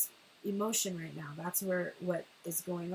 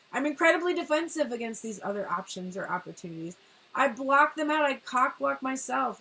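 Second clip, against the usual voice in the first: defensive outburst; 5 semitones higher, 7 dB louder than usual. Retold or imitated. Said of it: "And the feminine is like".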